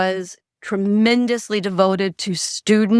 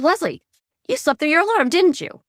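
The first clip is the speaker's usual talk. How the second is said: high, teasing wake-up voice, noticeably higher; teasing general; 9 semitones higher than usual. Retold or imitated. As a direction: tease her as the prank-calling general.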